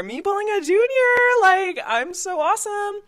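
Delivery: high, loud smug squeal; giddy bragging. Retold or imitated. Freshman gushing about landing a junior. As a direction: gush like a proud freshman.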